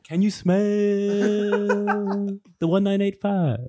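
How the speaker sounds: high, long-held wrestling catchphrase; hammed-up wrestler hype call; The Rock. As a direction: stretch it out like a ring promo, amused. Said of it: "one of those wrestlers entering the ring".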